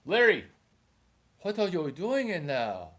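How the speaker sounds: lightly put-on dad voice, name called out loud; a hint of a Chinese father. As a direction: call your son sharply through a locked door. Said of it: "My dad doesn't talk like that".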